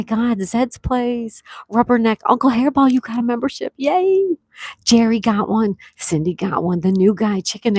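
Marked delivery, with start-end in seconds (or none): 3.8-4.4 high, bright squeal of joy; excited cheer. Real, not acted. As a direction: cheer the gift happily. Thanking viewers for memberships.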